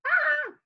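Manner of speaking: short high mock scream "Ah"; a quick high playful scream for the class; roller coaster scream. Shown as a demonstration. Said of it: "You're gonna scream".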